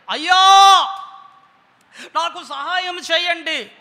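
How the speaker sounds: loud, high desperate plea to the master; a blind beggar's loud cry for help; crying out for mercy. Acted. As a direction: beg at the top of your voice. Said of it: "కేకలు వేశాడు".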